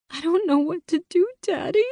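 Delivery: put-on high, choked teenage girl's voice; wheezing cry. A narrator's reading. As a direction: wheeze it out through tears. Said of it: "I wheezed out".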